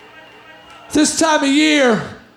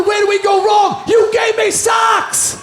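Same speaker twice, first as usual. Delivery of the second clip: parents lamenting; 6 semitones higher than usual. Retold or imitated. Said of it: "My parents going".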